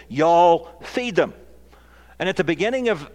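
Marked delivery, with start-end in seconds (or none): 0.1-1.3 "Y'all" drawn out loud and high; slow, loud order, jabbing at "Y'all"; pointed command. Retold or imitated. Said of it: "he points at the twelve and he says".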